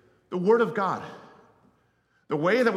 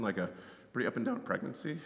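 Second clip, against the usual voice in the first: usual speaking voice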